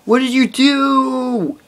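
high, slow, drawn-out angry cry at the brother; retold angry outburst. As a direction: shriek his name in slow disbelief. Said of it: "I was, like, swearing".

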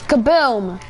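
usual speaking voice

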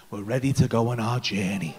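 low, hushed mock guru voice; low mystic guide. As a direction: announce the journey like a mystic. Shaman announcing trip.

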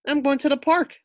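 put-on high, loud boy's voice; boy announcing. A narrator's reading. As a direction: call it out like a cheerful kid. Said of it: "Maxwell told Fluffy".

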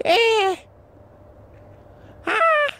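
very high imitated shriek; high mock ghost scream; imitating a scream. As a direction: mimic the eerie scream she just heard. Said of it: "I heard it scream like".

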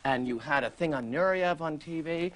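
normal talking voice

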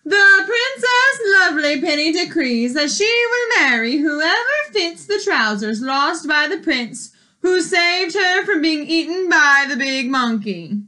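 loud herald's announcement; royal proclamation. Acted.

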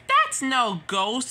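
tag. Acted. Percy exclaims at the revelation.

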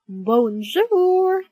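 loud, high, drawn-out bonjour. Acted. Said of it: "louder this time".

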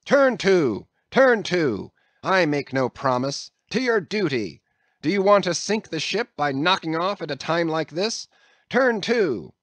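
captain barking orders at mutinous crew; shouted orders. A narrator's reading. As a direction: bark every order at the crew.

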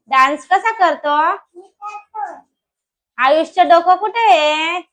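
slow sing-song with stretched final words. Real, not acted. Mother quizzing her toddler on body parts, sing-song prompting.

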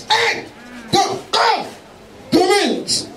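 ordinary talk, nothing marked

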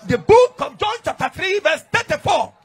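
loud, slow preacher call-out of the verse; preaching at full force. Real, not acted. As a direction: proclaim the reference to the congregation. Preacher calls out scripture.

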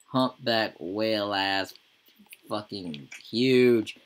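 marveling, slow and loud; drawn-out emphasis. Real, not acted. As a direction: drag "fucking huge" out to show how big. Marveling at pregnant sim's size.

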